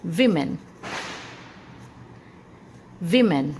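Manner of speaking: विमेन as "VIM-en"; short i first in विमेन; "vi" sound in women. Shown as a demonstration. Teacher giving the correct form, "VIM-en". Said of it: "इसका सही pronunciation है".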